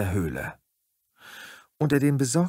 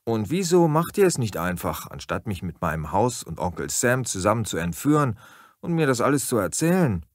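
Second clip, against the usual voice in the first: ordinary delivery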